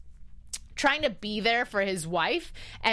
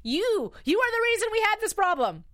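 higher, louder scold at the TV husband; emotional scolding; 10 semitones higher, 3 dB louder than usual. Real, not acted. Fan scolding Evan for snooping, with a raised, angry voice. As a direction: blame him straight to his face, worked up. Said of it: "I'm getting emotional here".

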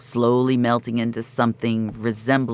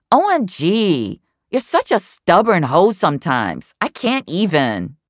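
higher, louder, drawn-out exasperation; Tara, exasperated; 7 semitones higher, 4 dB louder than usual. A narrator's reading. Tara snaps at stubborn Jamie, exasperated and louder. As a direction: roll your eyes with every word.

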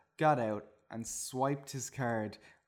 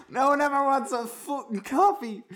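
very high, loud shriek; furious yell; 12 semitones higher, 9 dB louder than usual. A narrator's reading. Barista raging at a customer, high furious yell. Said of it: "yelled the barista".

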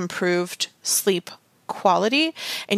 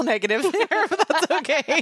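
laughing; 10 semitones higher than usual. Real, not acted. Laughs at own double negative.

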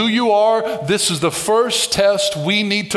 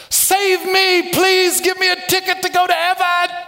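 high, pleading mock beggar for heaven; 10 semitones higher than usual. Preacher mimicking people who want only a ticket, high mock plea. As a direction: whine it like a beggar wanting a free ticket.

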